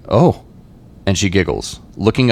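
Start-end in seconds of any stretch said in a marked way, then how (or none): 0.1-0.4 involuntary gasp. Acted. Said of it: "I let out an involuntary".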